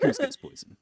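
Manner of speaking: laughing attempt at Kronk; Kronk impression. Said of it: "You think I can do a Kronk impression?".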